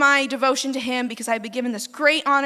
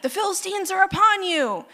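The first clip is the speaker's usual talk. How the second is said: high-pitched mock alarm call; mock yell; 9 semitones higher, 4 dB louder than usual. Delilah to sleeping Samson in the night, mock yell. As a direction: cry out a warning, voice pitched way up. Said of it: "then yell in the middle of the night".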